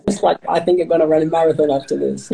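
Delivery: laughing it off, quick; giggly and hyper. Real, not acted. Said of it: "I didn't even drink coffee".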